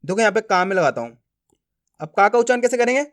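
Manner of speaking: normal talking voice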